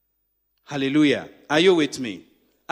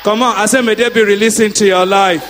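loud, rhythmic decree; preaching decree; 7 semitones higher, 8 dB louder than usual. Acted. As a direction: call it out over the crowd. Decreeing blessings to congregation.

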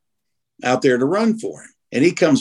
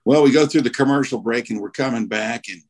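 usual speaking voice

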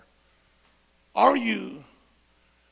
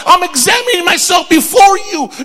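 loud, high preacher shout building to a peak; shouted sermon climax; full-force preaching; 9 semitones higher, 12 dB louder than usual. Acted. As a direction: thunder it at the congregation.

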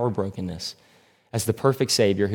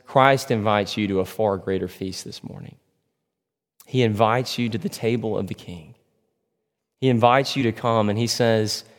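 ordinary talk, nothing marked

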